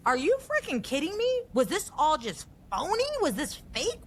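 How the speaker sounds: high-pitched, indignant questions; retold outrage, pitch leaping way up; hurt, angry disbelief. Woman recalling her anger at contacts who left her stranded, hurt and indignant. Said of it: "hurt and anger because I'm like".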